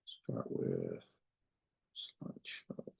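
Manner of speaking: barely audible whispered mumble; muttering to self. Real, not acted. Teacher hunting for the slideshow, whispering to self. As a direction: whisper it under your breath.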